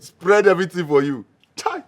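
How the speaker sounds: normal talking voice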